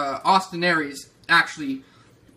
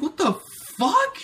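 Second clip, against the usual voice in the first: shocked what the fuck; 10 semitones higher than usual. Retold or imitated. Recalling seeing the announcement, a high stunned squeak. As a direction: voice shooting way up in disbelief. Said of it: "And I was like".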